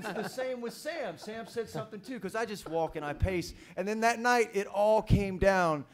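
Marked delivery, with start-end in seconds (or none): none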